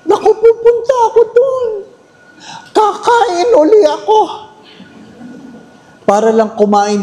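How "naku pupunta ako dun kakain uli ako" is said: much higher, quick, a touch of mock tears; eager high voice. Priest mimics himself rushing to eat again, high and tearful. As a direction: squeal it out like an overeager guest.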